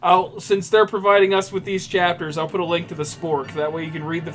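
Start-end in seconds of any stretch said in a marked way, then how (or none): none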